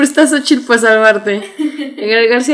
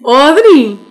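loud, higher cry of the name; exasperated correction; 4 semitones higher, 7 dB louder than usual. Acted. Sister correcting the name, loud and exasperated. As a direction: shout the name insistently.

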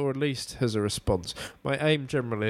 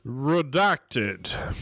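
robotic redacted; 3 semitones higher than usual. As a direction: announce it like a censor bleep. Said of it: "you've got to genuinely redact that".

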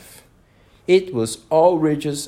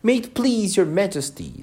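higher-pitched deference; very humble; 9 semitones higher than usual. A narrator's reading. Gardener humbly explains himself to the Queen. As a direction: address royalty very humbly. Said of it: "in a very humble tone".

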